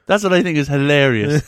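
ordinary talk, nothing marked

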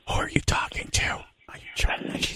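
mock whisper back. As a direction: hush it like a secret. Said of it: "Why are we whispering?".

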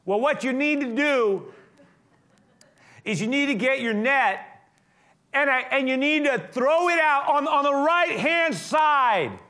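high, loud, slow call across the water. Acted. Jesus shouting from the beach to cast the net on the right. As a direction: shout the instruction to the boat. Jesus calls instructions from shore.

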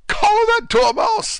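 high, loud furious shriek. Acted. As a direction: shriek it like a raging queen. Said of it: "the Queen shrieked out".

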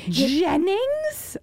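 high, drawn-out mock outrage; incredulous mom. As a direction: let the name climb into comic disbelief.

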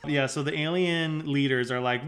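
ordinary delivery

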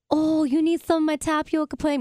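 high, exaggerated Minnesota lady accent; mock Minnesota accent. Retelling a movie lady's line in a mock Minnesota accent. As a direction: ham up a Minnesota grandma offering pudding. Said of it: "she says, like, way, way, way worse than I just said it".

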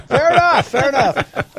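laughing it out, high and loud. Real, not acted. Host to co-host, amused by the excuse, laughing. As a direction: say it through a real laugh.

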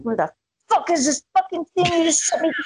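fast, high mock rant; imitating her own angry phone rant; retold freak-out. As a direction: flip out at mom, rushing. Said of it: "I call her flipping out".

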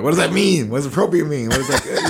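high, loud baffled questions; mock bafflement. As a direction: act out being baffled by the word.

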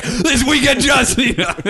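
high, hyped mock announcer; promo guy. Imitating the prank caller's wrestling promo through laughter, pitched high. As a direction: belt the name like a hype man, laughing. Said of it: "then the guy's like".

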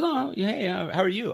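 high, breezy mock greeting; stranger at the bar. A stranger at the yacht club bar greets him, high and breezy. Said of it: "and goes".

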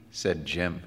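ordinary delivery

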